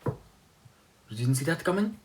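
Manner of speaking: high, slow put-on voice, rising at the end; stretched, high-pitched mock taunt; Pietro impression. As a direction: imitate a cocky catchphrase, drawn out and high. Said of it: "And then Pietro's like".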